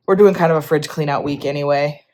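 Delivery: usual speaking voice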